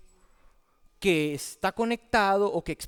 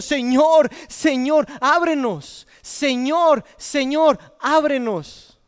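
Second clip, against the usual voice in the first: loud, high plea at a shut door; preacher acting out shouted begging; crying out; 6 semitones higher, 6 dB louder, slower than usual. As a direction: call out urgently to be let in. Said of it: "están gritando, interrumpiendo la ceremonia".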